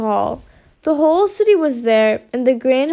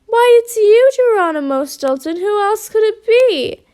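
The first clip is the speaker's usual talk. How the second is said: high, emphatic exclamation; loud start, pitch soaring; crying out; 7 semitones higher, 3 dB louder than usual. A narrator's reading. Horatio reveals the guest of honor, crying out. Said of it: "Horatio cried".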